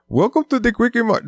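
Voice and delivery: high-pitched, exaggerated Indian accent; hammed-up Indian accent; mock Indian accent. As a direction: put on a cartoon Indian shopkeeper accent. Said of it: "it makes an Indian accent".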